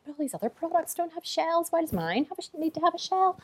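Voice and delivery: incredulous. Retold or imitated. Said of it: "And I was like".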